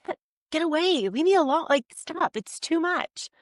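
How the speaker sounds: retold "get away" with real exasperation; exasperated push-away.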